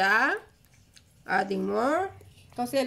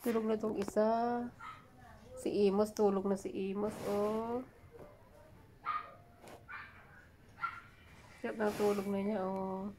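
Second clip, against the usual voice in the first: hushed; 2 semitones lower, 11 dB quieter than usual. Real, not acted. Baby asleep, speaking softly. (mahinang bulong para hindi magising ang bata)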